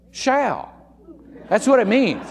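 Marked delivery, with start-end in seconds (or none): 0.1-0.7 "shall" said higher with a firm falling stress. Shown as a demonstration. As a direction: lean hard on "shall". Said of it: "in Scripture means".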